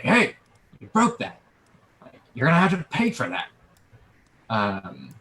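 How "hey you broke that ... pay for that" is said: loud, high-pitched angry vendor yell; vendor shouting. Acted. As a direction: shout accusingly across the market. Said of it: "start shouting".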